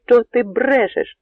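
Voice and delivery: high, loud, slow shout; shouting in disbelief. Acted. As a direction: bark it at the sentry, unable to believe. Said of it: "закричав Богун чатовому".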